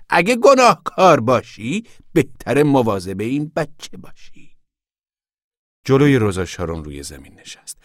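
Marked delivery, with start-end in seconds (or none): 0.1-4.6 high, slow, loud admonishment; sharp, angry. A narrator's reading. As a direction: warn her sternly, drawing it out. (با خشم و تندی، بلند و کشیده)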